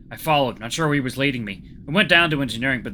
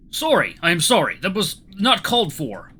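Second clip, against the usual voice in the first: put-on higher, contrite Russian accent; 6 semitones higher, 5 dB louder than usual. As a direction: apologise with feeling in a Russian accent. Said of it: "see if I can do a Russian accent".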